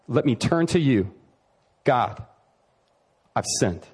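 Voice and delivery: humble confession. Retold or imitated. Voicing David turning to God.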